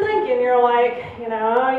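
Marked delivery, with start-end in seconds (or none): none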